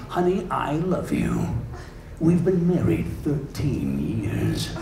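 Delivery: slow, low, soft announcer seduction; movie trailer narrator wooing his wife; romantic trailer voice. Acted. Trailer guy getting romantic with his wife, slow and deep. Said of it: "trying to get romantic with his wife".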